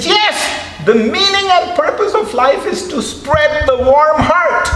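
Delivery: high, loud imitation of His Holiness; His Holiness clarifying. His Holiness explaining again to the puzzled audience, high and loud. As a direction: repeat warm heart clearly, high.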